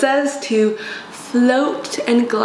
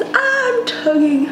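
mock guru voice; 14 semitones higher, much slower than usual. Doing makeup like gurus.

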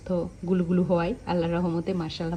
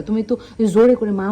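usual speaking voice

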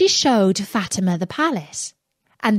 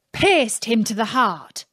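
plain reading voice

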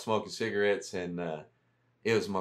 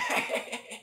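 high breathless laugh; 11 semitones higher than usual. Retold or imitated. Laugh after the impression.

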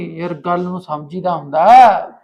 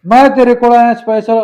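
higher, louder, hurt insistence; loud protest; 7 semitones higher, 8 dB louder than usual. Acted. Man protesting to his lover as she throws him out, loud. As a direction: protest that you came just for her.

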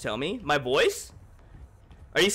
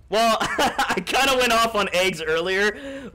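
laughing; 7 semitones higher, 4 dB louder, slower than usual. Real, not acted. Laughing confession.

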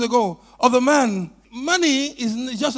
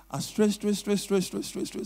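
mock whisper; 3 semitones lower, 7 dB quieter than usual. Whispering gibberish in king's ear.

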